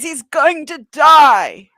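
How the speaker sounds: slow, loud wailing cry; dramatic despair. Acted. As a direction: wail it out, hammed-up. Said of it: "She says".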